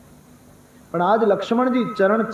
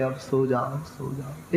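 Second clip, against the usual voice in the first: much softer, fading away; gentle urging; 3 semitones lower, 8 dB quieter, slower than usual. Acted. Rama coaxing Lakshman to go to sleep, soft gentle urging. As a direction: hush him off to sleep softly.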